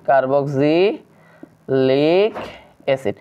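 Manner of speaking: very slow, low, drawn out in pieces; stretched syllables with pauses between; slow dictation. Shown as a demonstration. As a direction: spell it out by syllables as if writing along. Dictates slowly while writing it.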